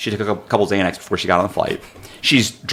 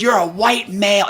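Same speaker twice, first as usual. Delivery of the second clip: high-pitched mock indignation; retold outraged jab; imitating loud accusation; 9 semitones higher than usual. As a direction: mimic an accusing passenger, voice pitched well up. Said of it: "And now she's loud".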